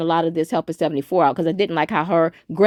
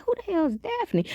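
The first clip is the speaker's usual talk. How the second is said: baffled squeak; 11 semitones higher, 7 dB quieter than usual. Retold or imitated. Baffled at a cartoon name.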